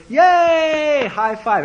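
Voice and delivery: high, loud mock group cheer; acted-out burst of celebration; excited cheer. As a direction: whoop it up like a celebrating crowd. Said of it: "Everybody's really excited. Everybody's really happy".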